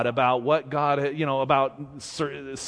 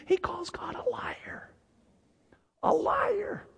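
whispered, much higher, breathless emphasis; hushed whisper; 8 dB quieter, slower than usual. Real, not acted. Preacher whispering to the congregation, stunned. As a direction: hush it like a scandal.

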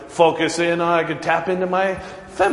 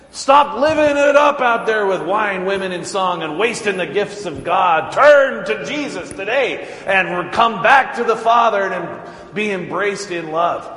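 raised, pleading revival preaching; call to repentance; 3 semitones higher than usual. Shown as a demonstration. Pastor demonstrating a repentance sermon to his congregation, preaching fervently. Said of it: "preach a, a sermon about, as a call to repentance".

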